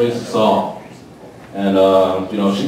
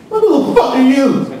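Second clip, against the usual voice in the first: mock indignant; 9 semitones higher than usual. Retort to brother's order.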